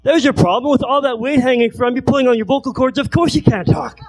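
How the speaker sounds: doctor's shock. Retold or imitated. Doctor reacts to naked patient.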